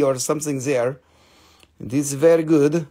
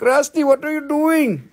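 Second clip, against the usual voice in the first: mock buyer; 8 semitones higher than usual. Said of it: "you will be like".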